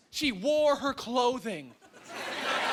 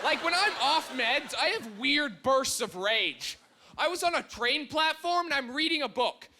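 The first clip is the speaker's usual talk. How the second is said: usual speaking voice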